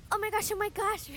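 high, eager gushing; excited exclamation. A narrator's reading. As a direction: gush it out in amazement. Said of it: "River explained".

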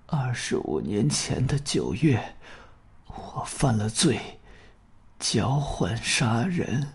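very soft and slow, dying; faltering weak voice. Acted. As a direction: drag out each word weakly. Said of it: "断断续续地说". (虚弱缓慢、断断续续地说)

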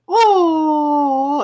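loud, very high drawn-out "aww"; teasing coo. Retold or imitated. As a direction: stretch out a high mocking coo at a softie. Teasing him for loving phone talk.

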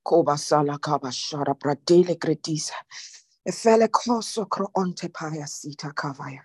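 low, hushed tongues prayer; praying in tongues. Real, not acted. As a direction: pour it out in tongues, low and inward. Praying in tongues after sermon.

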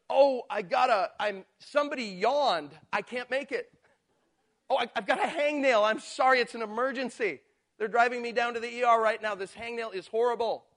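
high, loud whiny excuse voice; exaggerated excuses. Churchgoer dodging outreach with silly excuses, mock whining. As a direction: ham up a lame excuse. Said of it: "And I'm exaggerating".

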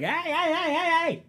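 hammed-up panicked hey; mock yelling hey. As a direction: call out hey like the shopper. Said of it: "She was just like".

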